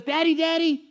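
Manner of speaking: put-on high, bright little-girl voice calling Daddy; excited little girl. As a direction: play a four-year-old bursting in. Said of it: "she bursts in the room and she says".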